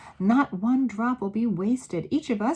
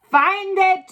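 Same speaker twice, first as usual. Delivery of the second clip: very high, loud command; scream; 12 semitones higher, 12 dB louder than usual. A narrator's reading. Grand High Witch screaming at her witches. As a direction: scream the order. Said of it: "screamed the Grand High Witch".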